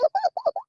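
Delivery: a shrill, high granny cackle; happy old woman's high laugh; high cackling laugh. Acted. Grandmother laughing.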